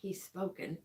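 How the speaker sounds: whispered aside. Real, not acted.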